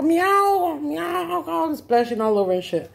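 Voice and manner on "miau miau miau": high, drawn-out meowing; yowling kitten. Acted. Storyteller as the kitten still screaming, high wails. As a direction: keep wailing like a silly drowning kitten. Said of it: "seguía gritando como pendejo".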